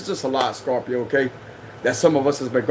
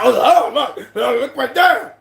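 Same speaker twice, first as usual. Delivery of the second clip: mocking tattletale; 7 semitones higher, 4 dB louder, slower than usual. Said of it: "That way they be like".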